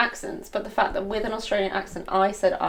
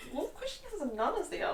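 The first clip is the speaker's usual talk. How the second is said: laughing; 8 semitones higher, 9 dB quieter, faster than usual. Real, not acted. Laughing at the nun mix-up.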